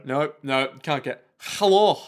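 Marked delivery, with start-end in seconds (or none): 1.4-2.0 Welsh accent. Shown as a demonstration. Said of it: "what the hell is the Welsh accent".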